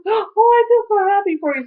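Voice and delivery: high, loud tearful gush, retold; imitated happy crying. Imitating the nurse who started to cry, happy tearful gush. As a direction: act out someone overcome with joy for you. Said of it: "And she starts to cry. She goes".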